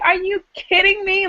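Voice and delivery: high-pitched incredulous retort; retold incredulity. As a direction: throw it back in mock disbelief.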